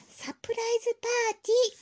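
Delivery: high frustrated shout of サプライズパーティー; exasperated shout. Acted. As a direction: yell it in exasperation. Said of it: "もうわかんないんだから". (苛立って叫ぶ)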